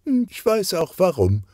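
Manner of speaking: high-pitched, knowing character line; knowing reply. A narrator's reading. Sonja's retort in dialogue.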